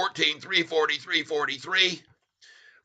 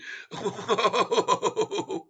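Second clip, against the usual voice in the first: high, breathy giggle; real giggle at the shorts; helpless tittering laugh; laughing at them; 5 semitones higher, 5 dB quieter than usual. Real, not acted. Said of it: "I have to laugh at 'em".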